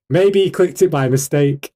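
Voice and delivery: normal talking voice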